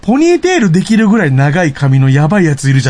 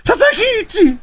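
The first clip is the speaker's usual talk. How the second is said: mock crying; 8 semitones higher, slower than usual.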